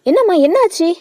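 high, anxious questioning; alarmed. A narrator's reading. Daughter finding her sister crying, alarmed. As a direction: ask urgently with pitch jumping up. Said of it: "அவள் பதறி போய் கேட்டாள்".

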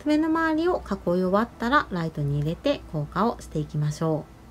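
normal talking voice

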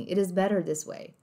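"It is better this way" with flapped Ts; American linking. Shown as a demonstration. Teacher recapping American, flapped Ts in "It is better". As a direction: soften both Ts and sound the R in "better".

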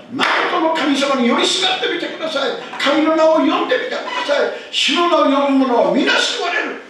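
fervent appeal. Acted. Pastor urging the congregation to pray, fervent appeal. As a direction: urge the listeners to call on God.

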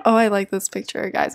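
ordinary talk

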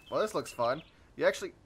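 ordinary talk

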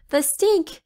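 lightly voiced high princess whine; a hint of a whiny princess; mildly disgusted high voice; princess in blankets. A narrator's reading. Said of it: "said the princess in blankets".

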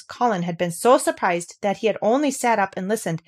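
plain reading voice

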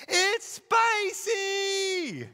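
put-on high, loud, drawn-out child's wail. Son complains about toothpaste, high drawn-out child's wail. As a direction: stretch it out like a kid in mouth-burn agony. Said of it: "And he says".

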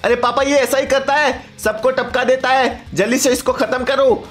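put-on high, pressing kid's voice; urgent son. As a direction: play the son urging papa to act.

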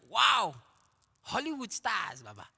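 wowed, pitch swooping up then falling; starstruck awe. Retold or imitated. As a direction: act out gawping at celebrities. Retelling his starstruck reaction.

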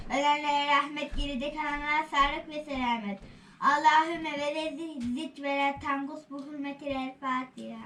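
chanted blessing prayer; prayer recitation. Acted.

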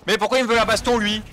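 high, loud outraged question; why is he picking a fight; indignant surprise. Real, not acted. Attacked by a game character. (surprise indignée, aiguë et forte)

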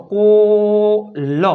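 કોલ with a long held 'o' and separate L; sounded out. Shown as a demonstration. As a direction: stretch કોલ slowly to blend the sounds. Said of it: "ક plus ઓલ". (કોલ લંબાવીને)

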